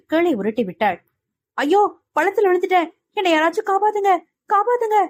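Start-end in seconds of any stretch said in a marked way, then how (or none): 1.6-5.0 high, fast, whining cries for help; sleep-talking wail. A narrator's reading. Mano wails for rescue in his sleep, high and whiny. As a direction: wail for help like a dreamer falling into a pit. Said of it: "உறக்கத்தில் புலம்பியபடி".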